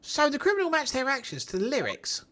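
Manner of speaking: put-on high, chirpy Cockney girl voice; high-pitched Cockney girl. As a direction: squeak it out like a Cockney kid. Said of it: "giving Trucy that high-pitched Cockney voice".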